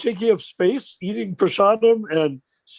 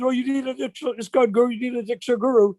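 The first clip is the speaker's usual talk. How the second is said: mocking everybody; 4 semitones higher than usual. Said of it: "So everybody says".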